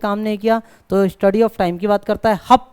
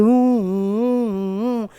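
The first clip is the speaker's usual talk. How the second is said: long wailing hoot of a siren; imitated siren; much slower than usual. Shown as a demonstration. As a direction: mimic an ambulance siren, sustained. (Siren की लंबी आवाज़ की नकल)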